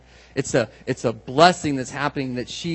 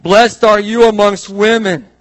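slow, high shouted blessing; loud proclamation; 5 semitones higher, 12 dB louder, slower than usual. Retold or imitated. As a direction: proclaim it like a trumpet call. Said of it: "She shouted".

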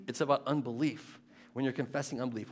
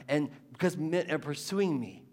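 normal talking voice

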